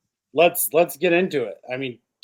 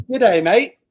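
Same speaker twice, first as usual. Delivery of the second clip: mock Australian greeting, hammed-up and louder; a jokey Aussie "Good day, mate"; Australian accent; 6 semitones higher, 5 dB louder than usual. Host greets the guest Torque in a mock Australian accent. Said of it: "we're doing Australian accents today".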